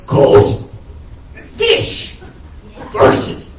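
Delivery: isolated words in a mock stage act; hint of a one-track stage character, word by word; lightly voiced comic's act. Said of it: "literally speaks only in the words".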